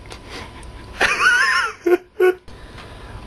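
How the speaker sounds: very high squealing burst of laughter; shrieking laugh. Real, not acted. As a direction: laugh wildly at your own joke. Bursts out after confessing lie.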